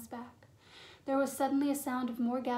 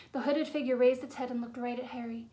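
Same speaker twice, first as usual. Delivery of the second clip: plain reading voice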